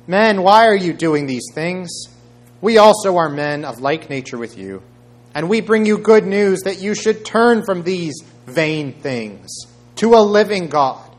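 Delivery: high, loud, urgent plea; crying out. A narrator's reading. Apostles protesting to the crowd, crying out loudly. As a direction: cry out over a crowd to stop them. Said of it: "crying out".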